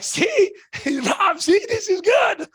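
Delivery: high, laughing, slow gloat; gleeful gloat. Retold or imitated. Bragging to Rob after Oren joins, laughing gloat. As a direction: crow with a laugh like you knew it all along.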